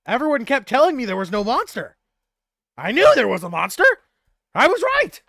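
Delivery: loud and high, vindicated; triumphant. Acted. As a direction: crow that you were right all along. NPC triumphant, proven right.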